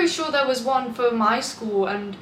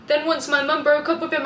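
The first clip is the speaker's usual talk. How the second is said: normal talking voice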